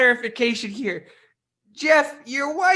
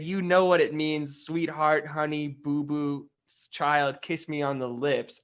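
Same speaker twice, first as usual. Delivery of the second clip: retold sweet talk, lower and softer, slowing; mock husband line, softer; 8 semitones lower, 6 dB quieter than usual. Investigator repeating husband's pet names to the wife, softer and lower. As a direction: drop low and soft through the pet names. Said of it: "And you said".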